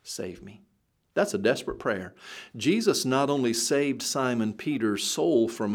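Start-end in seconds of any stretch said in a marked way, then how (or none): 0.0-0.6 hushed plea. Acted. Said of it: "call out".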